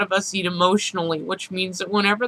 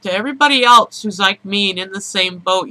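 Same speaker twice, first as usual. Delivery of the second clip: ordinary talk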